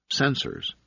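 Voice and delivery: usual speaking voice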